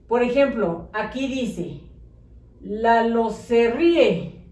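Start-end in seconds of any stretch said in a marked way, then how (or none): 2.6-4.4 "Lalo se ríe" read slowly as one jammed word; slow run-together reading. Shown as a demonstration. Teacher reading jammed-together words to the class, slow. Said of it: "¿Está bien escrito?".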